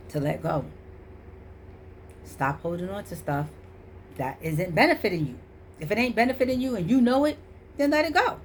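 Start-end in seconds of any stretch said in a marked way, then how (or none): 5.8-8.4 higher and louder, preaching; emphatic. Real, not acted. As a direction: push it out like a sermon. Preaching to viewers.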